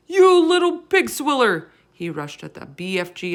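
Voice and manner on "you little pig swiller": high, loud giant insult; furious roar. A narrator's reading. Bloodbottler raging at the BFG, in a high furious roar. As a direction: spit out the insult in a rage. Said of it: "roared the blood bottler".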